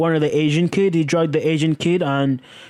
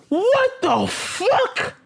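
very high, slow drawn-out shock; shocked outburst; 14 semitones higher, much slower than usual. Real, not acted. Horrified by Jeff drinking blood, shocked outburst. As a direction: stretch it out in disbelief. Horror at Jeff's crimes.

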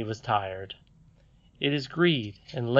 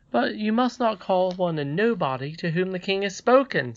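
higher-voiced boy standing his ground; protesting young Curdie; 6 semitones higher than usual. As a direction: object earnestly as a boy.

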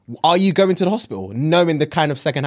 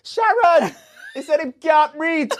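high, laughing shout to colleague; laughing call out; 11 semitones higher, slower than usual. Pretend. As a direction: call for help while cracking up. Operator calling colleague, laughing.

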